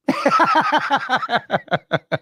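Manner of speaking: long high-pitched laugh; real laughter. Real, not acted. Storyteller cracking up at his stress card joke, real laughter. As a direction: crack up helplessly.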